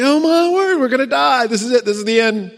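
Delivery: high-pitched, hammed-up panic; mock freak-out. Imagining the surrounded servants freaking out, mock panic. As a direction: play a wailing panic, starting very high. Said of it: "the servants just freaked out".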